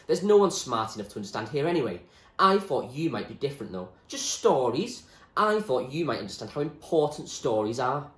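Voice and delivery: fierce William. Acted. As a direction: defend stories hotly. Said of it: "he said fiercely".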